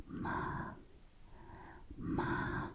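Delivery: hushed "ma" dipping and rising; third tone. Shown as a demonstration. Teacher repeating third tone, soft dipping "ma". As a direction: softly dip "ma" down and back up. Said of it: "is the third tone".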